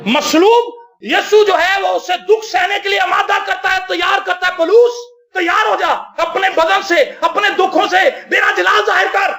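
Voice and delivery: commanding call. Acted. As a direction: call out to Paul with full authority. Jesus calls Paul, preaching. (بلند آواز میں پولوس کو حکم)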